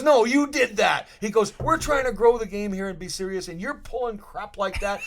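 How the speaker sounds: hammed-up coach-like demand; imitating angry Gretz.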